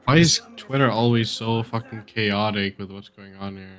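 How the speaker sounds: normal talking voice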